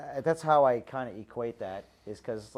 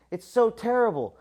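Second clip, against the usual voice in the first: much higher and louder, hammed-up wailing; a neighbor overplaying the bad news; mock lament; 8 semitones higher, 8 dB louder than usual. Neighbor lamenting son's injury.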